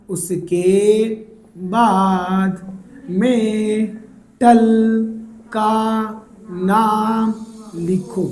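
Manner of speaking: very slow, word by word with long pauses; slow dictation. Shown as a demonstration. Teacher drilling students on the naming step, slow dictation. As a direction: stretch each word out and pause after it.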